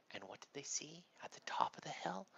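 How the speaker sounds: hushed, breathy storyteller whisper; spooky storytelling whisper. A narrator's reading. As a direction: whisper it like a campfire secret.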